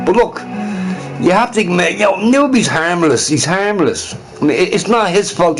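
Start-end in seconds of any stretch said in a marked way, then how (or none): none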